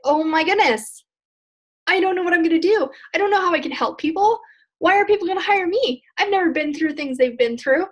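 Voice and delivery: high-pitched, fretting self-doubt; acted freaking out. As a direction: play a new coach spiralling into fear. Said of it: "you start going into fear".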